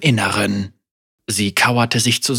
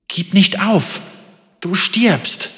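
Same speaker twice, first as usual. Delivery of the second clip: raised, pressing plea to keep going; urgent high call; 8 semitones higher than usual. Acted. Inner voice urging fallen Senna on, high and pressing. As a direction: push her to her feet, voice up high.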